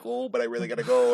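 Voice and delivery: high, whiny mock begging; mock pleading. Prisoner begs her captors to let her go, mock pleading.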